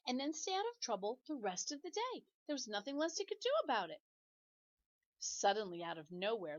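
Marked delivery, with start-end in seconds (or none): none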